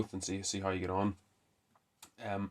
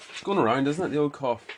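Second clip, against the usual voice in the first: loud, high pet-directed voice; talking to the dog; 7 semitones higher, 9 dB louder than usual. Real, not acted. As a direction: raise the voice fondly to the coughing dog. Turns to his coughing dog.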